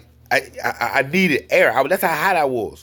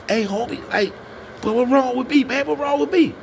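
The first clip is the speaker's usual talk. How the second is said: retold panic; 11 semitones higher than usual. Retells his panicked plea to homie.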